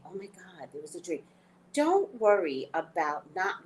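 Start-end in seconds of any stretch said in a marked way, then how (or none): 0.1-1.2 much softer, hushed "oh my God"; hushed realization. Retold or imitated. Waking at three three three after a dream, in a soft, hushed realization. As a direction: breathe out the realization quietly. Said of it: "And you're like".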